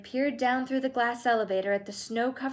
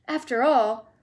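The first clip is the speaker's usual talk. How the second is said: raised, brisk and bright; excited cry; 5 semitones higher, 6 dB louder than usual. Acted. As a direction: cry out eagerly. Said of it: "cried Mister Wonka".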